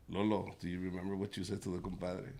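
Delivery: low soft questioning; quiet. Retold or imitated. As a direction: ask softly and fast. Mom quietly next morning.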